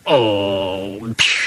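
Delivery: long low moan ending in a puff; sulky low "oh" and hiss; disgruntled groan. A narrator's reading. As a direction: groan wearily, pitched low, then let off steam. Gordon groans at being teased.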